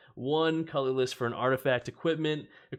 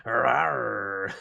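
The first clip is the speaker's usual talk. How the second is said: playful roar; 8 dB louder than usual. Pretend. Lion growl after lion man.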